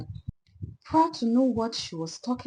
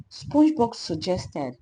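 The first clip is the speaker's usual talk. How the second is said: plain reading voice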